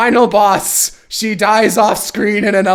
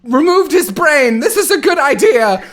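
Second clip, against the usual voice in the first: much higher, louder, prissy villain voice; mock villain; 8 semitones higher, 3 dB louder than usual. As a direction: ham up a silly villainess. Villain Dante boasting.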